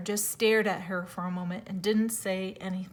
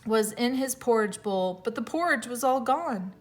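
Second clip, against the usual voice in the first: ordinary delivery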